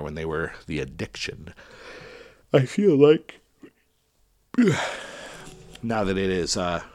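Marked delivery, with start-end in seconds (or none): 2.5-3.4 words stretched by a yawn. Real, not acted. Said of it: "I'm yawning". 4.5-5.5 long breathy yawn; big yawn. Real, not acted. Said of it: "I'm yawning".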